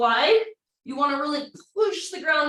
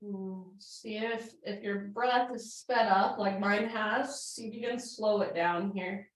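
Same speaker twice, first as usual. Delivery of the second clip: soothing yoga cue; 3 semitones lower, 4 dB quieter than usual. Acted. Calm cool-down cueing.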